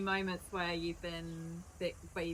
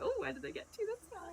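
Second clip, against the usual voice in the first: much higher, eager wondering voice; curious quote. Retold or imitated. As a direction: pitch up like an eager fan. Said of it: "You're like".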